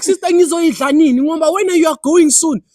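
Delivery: loud, high, pressing question; impatient demand. Retold or imitated. Boyfriend asking girlfriend when the friend leaves, impatient loud demand. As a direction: retell it as an exasperated demand.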